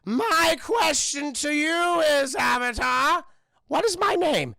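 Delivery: loud, high, drawn-out king's challenge; Bumi. Retold or imitated. The king poses his question to Aang, loud theatrical challenge. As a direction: proclaim the riddle in a big theatrical voice. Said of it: "And he's like".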